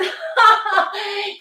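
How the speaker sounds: high, sing-song laugh; amused laugh. Real, not acted. Teacher joking about handsome men at the gym, amused laugh. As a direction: laugh at your own cheeky joke.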